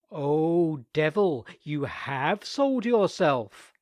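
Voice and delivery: loud, slow, dramatic denunciation; mock accusation. A narrator's reading. Nicholas denouncing the devil in the tank, hammed-up. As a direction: pronounce it like a verdict.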